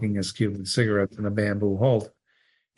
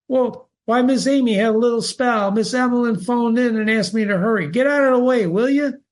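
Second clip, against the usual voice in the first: hurried doctor; 12 semitones higher, 3 dB louder than usual. Acted. Doctor hurrying Alex aside.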